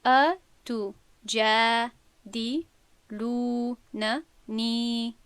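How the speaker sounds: ا تو جا دی لو ن نی with only the long vowels held two beats; slow correct recitation. Shown as a demonstration. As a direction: recite very slowly, stretching just the madd letters. (تلاوت آهسته و درست)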